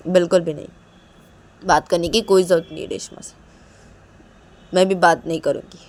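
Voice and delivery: loud, emphatic refusal; adamant, angry daughter. Acted. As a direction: forbid mother firmly, hitting the first words hard. Daughter forbids mother to talk. (ज़िद्दी, नाराज़ बेटी का ज़ोरदार इनकार)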